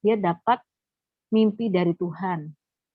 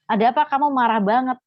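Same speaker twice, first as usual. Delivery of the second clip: louder, higher, concerned confrontation; urgent questioning; 5 semitones higher, 7 dB louder than usual. Retold or imitated. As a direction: press a friend for an answer, retelling it. Retold confronting comatose friend. (bertanya mendesak dengan suara keras)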